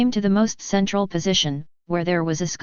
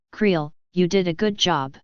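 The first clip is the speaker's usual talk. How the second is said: usual speaking voice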